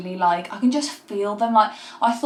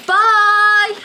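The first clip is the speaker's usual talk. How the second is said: loud high bye; 11 semitones higher, 10 dB louder than usual. Real, not acted. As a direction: call out bye cheerfully. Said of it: "calls bye leaving".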